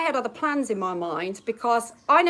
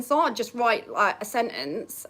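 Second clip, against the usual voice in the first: ordinary delivery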